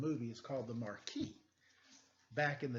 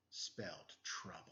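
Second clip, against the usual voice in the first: hushed, secretive narration; noir whisper; 9 semitones lower, 11 dB quieter than usual. A narrator's reading. Detective confiding to the listener, whispered. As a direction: breathe the line like a private eye.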